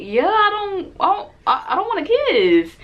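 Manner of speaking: flustered high-pitched "I don't want a kiss"; exasperated refusal. Retold or imitated. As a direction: spell it out in exasperation.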